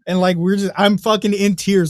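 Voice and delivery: usual speaking voice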